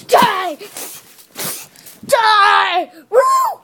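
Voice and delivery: high loud battle cry; shouting. Pretend. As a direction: scream it at the toy fighter. Toy fight cheering.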